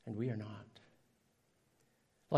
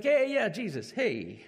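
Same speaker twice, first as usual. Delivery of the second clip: higher, louder breezy hello; mock casual greeting; 6 semitones higher, 5 dB louder than usual. Mocking a careless believer greeting Jesus, casual. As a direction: toss it off like greeting a buddy. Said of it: "We can't be like".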